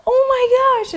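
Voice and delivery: very high, squeaky imitated weeping; hammed-up tearful squeal; crying. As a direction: mimic a woman tearfully crying out. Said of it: "she's crying".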